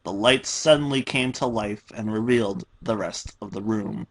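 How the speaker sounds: ordinary delivery, nothing marked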